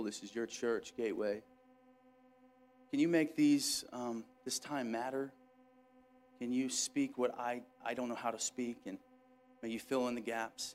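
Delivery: soft, low, intimate prayer; quiet prayer. Real, not acted. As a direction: pray it humbly, close to the mic. Pastor prays before preaching.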